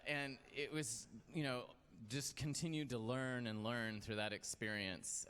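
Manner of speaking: ordinary delivery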